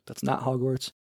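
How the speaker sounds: usual speaking voice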